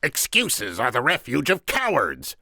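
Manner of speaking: high, loud, contemptuous interruption; cutting in. A narrator's reading. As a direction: cut him off with a scornful cry. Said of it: "the Sicilian interrupted".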